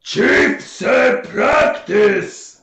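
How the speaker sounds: loud, high, very slow bellow; ogre roar. Acted. Ogre telling player the chief's order, slow roaring. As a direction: bellow each word slowly as an ogre.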